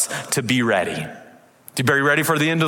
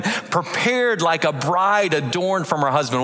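scripture reading; 5 semitones higher than usual. Acted. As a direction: read the vision out with rising wonder. Preacher reading scripture aloud.

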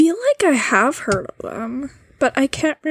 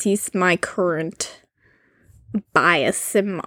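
slow, low and halting; odd, halting delivery; 3 semitones lower, slower than usual. Real, not acted. Co-host telling of a favorite idol, oddly slow and low. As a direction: drag it out slowly in a lowered voice. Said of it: "why am I talking like this?".

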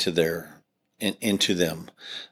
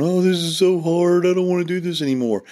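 high, whiny complaining voice, much higher; mock whining; 10 semitones higher than usual. Pastor mocking leaders who want to give up, high mock whine. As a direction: whine it like a worn-out quitter. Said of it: "is not whining and crying and complaining and going".

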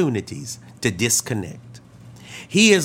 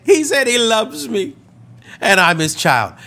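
high-pitched retold cry, easing down by the end; imitated tearful joy; 7 semitones higher, 4 dB louder than usual. Woman answering the pastor's question, imitated high tearful wonder. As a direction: play an overcome woman, starting high. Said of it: "tears began to pour from her eyes".

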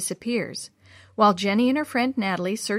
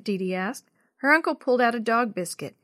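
plain reading voice

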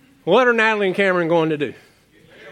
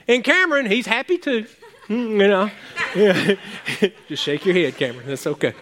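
ordinary talk

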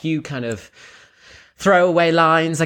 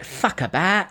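higher-pitched Cockney; Cockney accent; 5 semitones higher, slower than usual. Shown as a demonstration. Demonstrating the trigger phrase to a co-host, in Cockney. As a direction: put on a Cockney voice for the catchphrase. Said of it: "Cockney ac".

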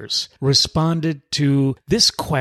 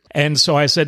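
ordinary delivery

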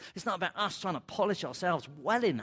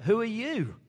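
startled question; 7 semitones higher, 5 dB louder than usual. Acted. Moses asking God's name.

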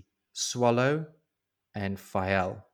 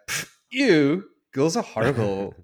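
put-on high, loud, scoffing young boy voice; scornful kid; 6 semitones higher, 7 dB louder than usual. Young cousin scoffs at talk of grandchildren, mock kid voice. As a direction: scoff like a kid who finds girls gross.

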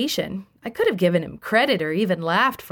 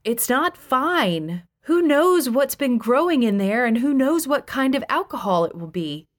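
melodramatic; 6 semitones higher than usual. A narrator's reading. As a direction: play up the danger for effect. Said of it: "I said melodramatically".

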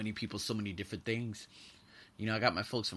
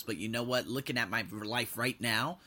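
normal talking voice

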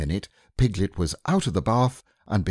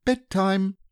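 higher, fond motherly voice; a mother calling her baby to bed; Kanga's voice; 13 semitones higher than usual. Acted.

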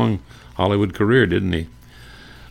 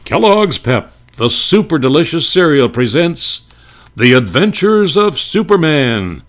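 much higher, louder booming radio intro; radio announcer; 6 semitones higher, 6 dB louder than usual. Retold or imitated. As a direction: sell it like a golden-age announcer. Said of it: "Remember, it went, uh, something like this".

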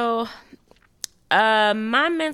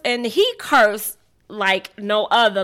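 ordinary delivery

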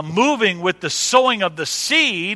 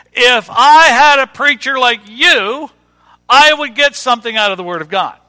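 gushing church member; 5 semitones higher, 7 dB louder than usual. Retold or imitated. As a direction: imitate an emphatic admirer, higher and louder. Quoting people gushing to him.

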